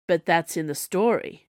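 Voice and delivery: plain reading voice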